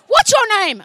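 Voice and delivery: "What's your name?" shouted high and loud; woman shoving her face close, shouting. Retold or imitated. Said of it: "She came right into my face".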